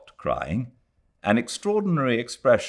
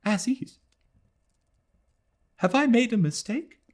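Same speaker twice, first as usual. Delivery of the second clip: put-on higher, fragile girl's voice; a frightened girl doubting herself; anxious young woman. A narrator's reading. Adela voiced high, doubting.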